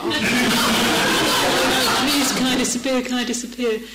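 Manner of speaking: high, loud comic pleading; mortified inner plea. Retold or imitated. Passenger wishing to escape the strange man heading over, mortified pleading. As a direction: plead to vanish, half laughing. Acted embarrassed thought on the bus.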